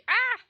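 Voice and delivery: sharp high hiccup yelp; a sudden hiccup cry. Real, not acted.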